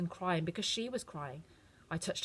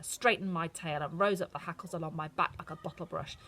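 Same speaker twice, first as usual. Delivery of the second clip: usual speaking voice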